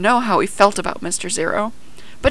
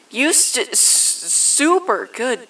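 halting, stuttered start on the praise; flustered boss stumbling into a speech; stammering announcement; 5 semitones higher, slower than usual. A narrator's reading. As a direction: trip over the first sounds. Mister Zero stumbles over his announcement.

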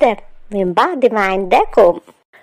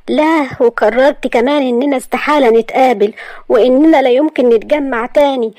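put-on hurt indignant girl; 7 semitones higher than usual. Maria answering Asad's lecture, high and sarcastic. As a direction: throw his words back at him.

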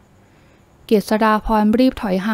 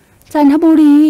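a sudden, slow, high and loud cry of the word จันทบุรี; shocked cry; 6 semitones higher, 9 dB louder, much slower than usual. A narrator's reading. Girl hears where he is taking her, shocked cry. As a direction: gasp the name จันทบุรี out in disbelief. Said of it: "ร้องตกใจ".

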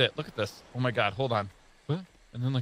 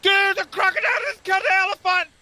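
excited shout; 12 semitones higher than usual. Real, not acted. Shocked gamer sees crocodile kill.

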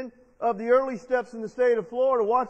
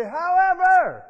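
higher and louder, slow; emphatic call; 6 semitones higher, 8 dB louder, slower than usual. Shown as a demonstration. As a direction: belt out the word however. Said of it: "Say it like you mean it".

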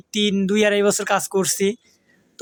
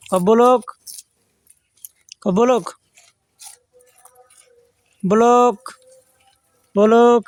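loud coaching call; 5 dB louder than usual. Real, not acted. Vlogger loudly drilling his goat to say blog, loud call. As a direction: coax a goat to say the word, loud.

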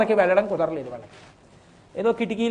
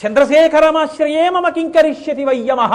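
higher, louder ringing verse; defiant proclamation; 6 semitones higher, 9 dB louder than usual. Acted. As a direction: fling the verse at Yama. Boy defies Yama with verse. (యముడిని ధిక్కరిస్తూ గట్టిగా)

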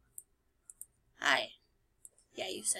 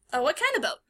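ordinary talk, nothing marked

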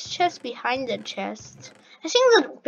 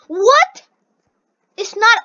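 high, loud disbelieving what; outraged what; 8 semitones higher, 10 dB louder than usual. Real, not acted. Gamer realising it's no checkpoint, high outraged disbelief.

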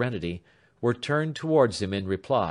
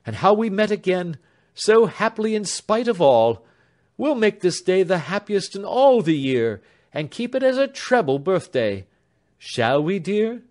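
raised, happy voice of the elder sister; bright elder sister; 9 semitones higher, 6 dB louder than usual. A narrator's reading. Elder sister answering Alfred, eager and raised. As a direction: hurry them along with joy.

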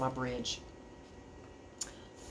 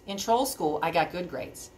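usual speaking voice

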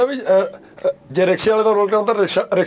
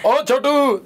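police dialogue line; 6 semitones higher, much slower than usual. Shown as a demonstration. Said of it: "ਦੋ ਤਿੰਨ ਤਰੀਕੇ ਨਾਲ ਬੋਲਿਆ ਜਾਂਦਾ".

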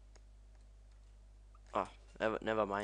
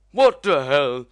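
very loud, raised angry yell; mad shout; 4 semitones higher, 19 dB louder than usual. Real, not acted. Gamer mad that Happy Wheels froze, furious shout. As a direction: explode at the screen. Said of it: "I'm mad now".

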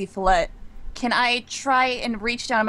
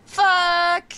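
high, loud curse; frustrated shout; 9 semitones higher, 5 dB louder than usual. Real, not acted. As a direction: yell out over a bad roll. Reacts to a bad dice roll.

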